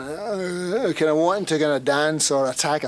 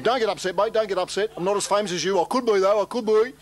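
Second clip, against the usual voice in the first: higher, wheedling footy bloke; drunk fan placating; 7 semitones higher than usual. Retold or imitated. Stage-crasher reassures the singer, higher and wheedling. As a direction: soothe him like a cheeky drunk. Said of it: "he was going".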